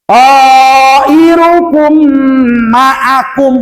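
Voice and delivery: QAIRUKUM drawn very slowly, long first vowel and held m; correct recitation. Shown as a demonstration. Teacher models the reading, slow chant. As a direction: chant qairukum ma'akum on a steady note. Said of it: "ya, baca tuh".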